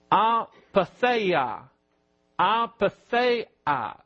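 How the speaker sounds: "apatheia" very slowly, ah-pah-THAY-ah; Greek word pronounced. Shown as a demonstration. Preacher teaching the Greek word "apatheia", slowly sounded out. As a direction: sound out "apatheia" syllable by syllable, drawn out. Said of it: "The Greek word there is".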